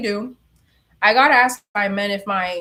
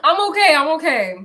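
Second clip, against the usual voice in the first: breathless laugh, louder; 6 semitones higher, 6 dB louder than usual. Real, not acted. Recovering from granola coughing, laughing breathlessly. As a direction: laugh through the choke. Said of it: "Let me catch my breath".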